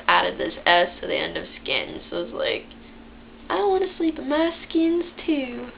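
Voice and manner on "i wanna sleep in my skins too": high-pitched imitation of her childhood accent; little-kid voice. Imitating herself when little, high childlike voice. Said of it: "With that accent. That's exactly how I talked when I was little".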